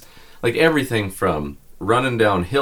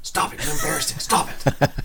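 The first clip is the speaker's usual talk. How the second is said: urgent whisper; 3 dB quieter than usual. Retold or imitated. Wife embarrassed, hushing him in hallway.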